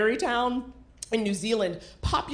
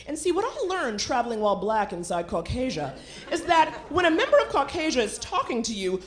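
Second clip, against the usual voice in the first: normal talking voice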